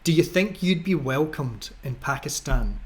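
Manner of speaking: ordinary talk, nothing marked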